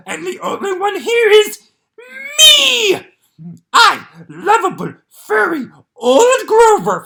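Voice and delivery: the furry monster reveals himself; Grover voice. Acted. Grover voice reading.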